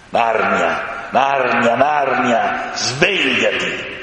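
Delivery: slow, grand call to awaken; a great lion calling the land awake; lion's solemn call. A narrator's reading. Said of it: "Quella era la voce del leone". (voce solenne del leone che chiama)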